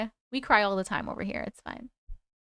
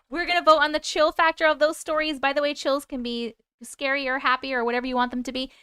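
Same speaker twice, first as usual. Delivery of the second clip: ordinary delivery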